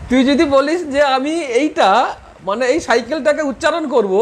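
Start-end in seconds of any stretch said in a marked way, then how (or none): none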